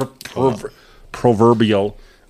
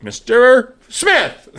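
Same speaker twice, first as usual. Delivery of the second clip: imitated sales caller, higher, louder, stretched out; telemarketer; 8 semitones higher, 5 dB louder, much slower than usual. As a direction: sing out the name like a cold caller. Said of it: "when you answer one of these spam calls".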